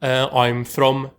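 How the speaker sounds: hesitant Norwegian English with long-held filler; Scandinavian accent. Shown as a demonstration. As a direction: do a party-trick Norwegian accent, slowly, dragging the pause. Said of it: "I'll do the voice".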